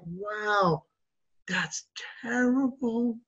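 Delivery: slow, near-whispered commiseration; drawn-out whispery mock concern; hushed sympathy. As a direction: play along in a soft, awed hush. Acted sympathy for the drunk man.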